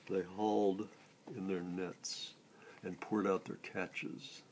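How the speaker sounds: ordinary delivery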